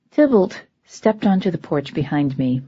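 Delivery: ordinary delivery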